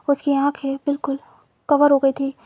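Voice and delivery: ordinary delivery, nothing marked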